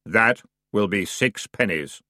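slow, dry, weary boatman; bored underworld ferryman; grim ferryman voice. Acted.

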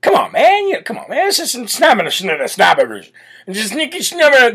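mumbling Biden, pitch jumping high on Come on, man; Joe Biden impression. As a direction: slur the words into mushy snaps. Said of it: "Joe Biden here sniffing over my shoulder".

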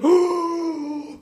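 high gasped "oh"; crowd gasp. Retold or imitated. Retelling the crowd's shocked gasp at the awards. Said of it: "everyone was like".

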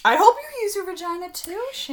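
high, playful teasing voice; teasing sing-song. Acted. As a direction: tease her in a sing-song. Teasing friend about her trip.

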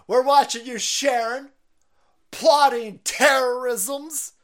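high, loud accusing agent voice; mock FBI agent shouting. Mocking FBI agents, yelling at a churchgoer. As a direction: bellow accusations like a paranoid fed. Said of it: "F B I agents currently watching this".

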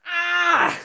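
high loud yelp of embarrassment; shrieking at the memory; mock scream of shame. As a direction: let out a cringing scream. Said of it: "just, like".

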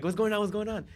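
high, loud mock panic; startled awake. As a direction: act out jolting awake, confused. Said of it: "waking up all like".

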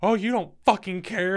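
high, loud imitation of her accusation; upset girlfriend. Girlfriend on the floor to him, high mock upset cry. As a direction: throw it back, high and hurt. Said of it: "she looked up at me and went".

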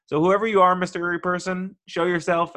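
high, loud beckoning; calling out. Acted. Calling out to the listener.

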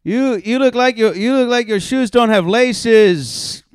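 loud, high, drawn-out yelled insult; overconfident heckling. Retold or imitated. Drunk guy insulting people who go up, overconfident heckling. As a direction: imitate a drunk amateur belting out a bad joke. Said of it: "saying it with the confidence".

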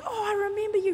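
high-pitched mock-surprised greeting; surprised recognition. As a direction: act out suddenly recognising someone. Retold greeting of old acquaintance.

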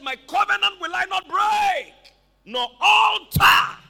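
high, slow, full-voiced shout; thundering declaration. Preacher quoting God's covenant promise, high thundering shout. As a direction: proclaim it as God's unbreakable word.

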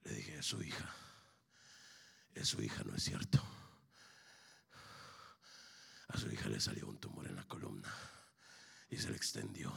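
soft, low preacher storytelling; hushed testimony. Acted. Preacher telling a father his God can heal his daughter, hushed testimony. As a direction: draw the congregation in quietly with pauses.

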